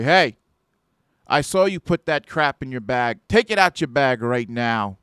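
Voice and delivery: loud, sharp demand to hand it over; confrontational guard yell. Retold or imitated. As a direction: bark it like a guard catching a thief.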